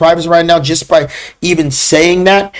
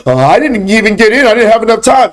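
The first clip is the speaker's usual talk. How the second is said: high-pitched mock student whine; complaining student; 6 semitones higher than usual. Instructor mimicking students who missed the trade, complaining student voice. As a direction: imitate a student making excuses. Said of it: "like some of the students right now".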